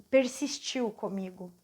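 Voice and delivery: ordinary talk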